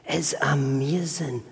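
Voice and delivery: hushed, breathless whisper of wonder; awed whisper. Retold or imitated. Preacher voicing a dazzled child, whispered in awe. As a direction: breathe it like a child seeing treasure.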